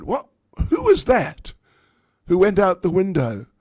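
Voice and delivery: put-on high, loud old woman asking who fled; shrill-voiced suspicious mother-in-law. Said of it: "And Jatila said".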